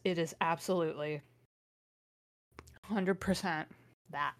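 ordinary delivery

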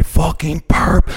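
slow high sneering taunt; mock sneer. Taunting the stranger, sneering and slow. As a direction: drag out the insult. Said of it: "So I go".

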